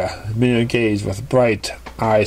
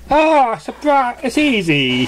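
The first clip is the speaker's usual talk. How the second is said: breezy dismissal; 10 semitones higher, slower than usual. Acted. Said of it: "said Surprise, waving ... dismissively".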